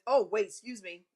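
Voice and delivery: ordinary talk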